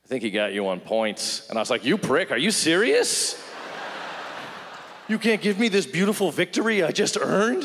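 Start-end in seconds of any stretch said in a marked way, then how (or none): 0.0-1.5 understated, unimpressed father; reticent dad. Retold or imitated. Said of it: "emotionally reticent guy". 1.8-7.6 high, exasperated protest; acted incredulous anger, pitched up; indignant outrage. Son outraged at dad's verdict.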